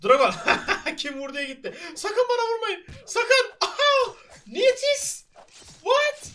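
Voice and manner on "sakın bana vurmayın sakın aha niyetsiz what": high squeals through laughter; much higher, giggly pleading; laughing panic. Real, not acted.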